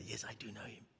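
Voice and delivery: whispered confession never spoken. Acted. Peter's unsaid answer to the girl, whispered. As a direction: breathe it out as an unvoiced secret.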